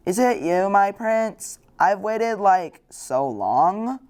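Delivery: wondering princess. Acted. Waking princess greets the prince who kissed her, high and slow.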